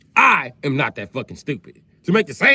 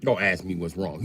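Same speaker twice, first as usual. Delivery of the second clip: normal talking voice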